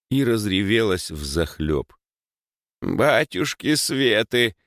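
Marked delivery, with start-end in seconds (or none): 2.8-4.6 slow, higher village-granny wail; lamenting granny. A narrator's reading. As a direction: lament in drawn-out dismay. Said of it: "запричитала баба Маша". (причитая по-бабьи)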